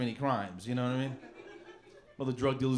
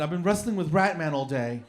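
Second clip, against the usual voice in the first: lightly camp disguised superhero; prim, mock-serious menace; effeminate Catman voice; 3 semitones higher than usual. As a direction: play a fussy, dainty vigilante making threats. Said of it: "I'm saying like this for some reason".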